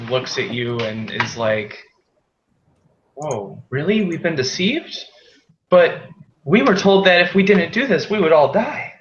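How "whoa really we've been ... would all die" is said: startled laborer. Acted. Laborer realising he was deceived.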